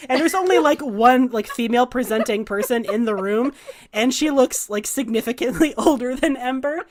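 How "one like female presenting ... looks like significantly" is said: ordinary talk, nothing marked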